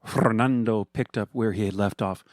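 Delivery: plain reading voice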